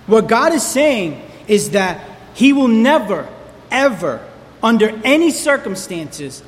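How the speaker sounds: ordinary delivery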